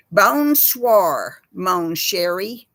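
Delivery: hammed-up French said in a Texas twang; mock French. Shown as a demonstration. Showing how French sounds from a Texan. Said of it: "I'll give you an example".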